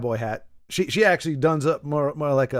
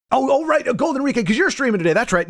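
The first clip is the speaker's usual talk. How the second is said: sudden remembering; 10 semitones higher, 3 dB louder than usual. Real, not acted.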